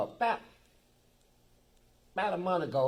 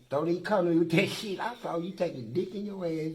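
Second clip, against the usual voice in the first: fast, loud angry retort, louder at the start; a heated retold comeback; retold angry outburst; 4 semitones higher, 7 dB louder than usual. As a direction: tell him off hard, replaying the fight. Retold confronting a man in prison.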